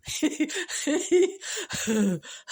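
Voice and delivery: hearty laugh at her own joke. Real, not acted. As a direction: bursts out laughing.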